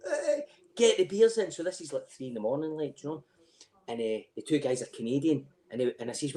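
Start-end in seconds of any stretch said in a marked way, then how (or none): 0.1-1.5 jubilant. Retold or imitated. Celebrating finding the match.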